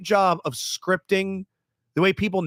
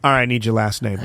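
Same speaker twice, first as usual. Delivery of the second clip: lower, all-business and flat-toned; accent dropped, curt; 5 semitones lower than usual. Retold or imitated. Cruise agent turning curt when he cancels. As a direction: drop the charm and get down to business. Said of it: "Her fucking accent went away".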